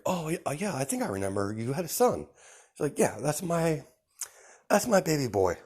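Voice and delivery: proud gushing mother. Retold or imitated.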